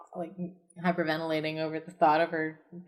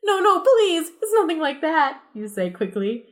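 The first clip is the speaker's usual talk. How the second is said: high, giggly pleading; panicked kid; 10 semitones higher, 4 dB louder than usual. Acted. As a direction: rush to deny it.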